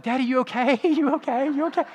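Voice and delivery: put-on high, small child's voice asking; worried little girl. Father imitating daughter checking on him, high child voice. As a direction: imitate a concerned four-year-old. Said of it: "She's like".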